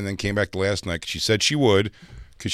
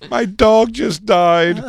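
high, slow, loud wail; drawn-out mock sobbing delivery; mock hysterical crying; 12 semitones higher, 6 dB louder, much slower than usual. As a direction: imitate a distraught woman wailing. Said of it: "like, hysterical crying".

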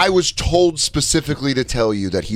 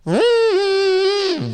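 long, high whining guitar note; squealing guitar, done vocally; mouth-made electric guitar noise. Retold or imitated. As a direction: imitate a guitar solo with your mouth. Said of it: "guitar noises with his mouth".

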